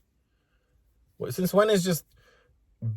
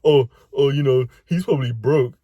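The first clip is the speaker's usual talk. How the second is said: mocking woman; 3 semitones lower than usual.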